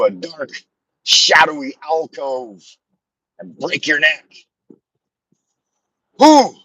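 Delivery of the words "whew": high, loud exhale of relief; loud whew. Real, not acted. After the grim bunny story, a loud whew. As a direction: blow out the tension. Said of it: "That's a lot".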